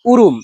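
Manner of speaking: উরুম set apart as the local word for puffed rice; dialect word. Shown as a demonstration. Villager naming the local word for puffed rice. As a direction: present উরুম clearly. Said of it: "আঞ্চলিক ভাষায় এটাকে বলে".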